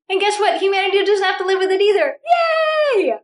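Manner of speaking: rally the group with a joyful yell; excited cheer. Real, not acted. Cheering group at session close.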